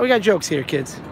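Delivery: ordinary talk, nothing marked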